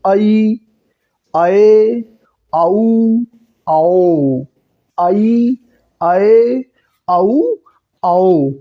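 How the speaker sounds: each of "आई, आए, आऊ, आओ" drawn out, both vowels heard; chant-like drill of "आई, आए, आऊ, आओ", a pause after each; pronunciation drill. Shown as a demonstration. Teacher drilling learners to imitate, careful separated "आई, आए, आऊ, आओ". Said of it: "इस तरह बोलने का प्रयास करिए".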